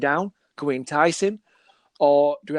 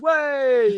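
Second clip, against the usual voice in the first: high, drawn-out "way" like a horn; train horn honk; 11 semitones higher, 4 dB louder than usual. Retold or imitated. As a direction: hoot it like a passing train. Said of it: "it honks its horn".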